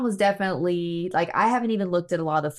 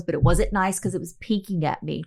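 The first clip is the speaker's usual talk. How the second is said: normal talking voice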